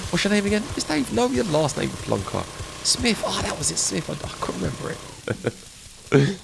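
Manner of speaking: high, bouncy playacted exchange; acted mini-dialogue. Acting out asking someone their name, high and playful. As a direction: act out fishing for a name.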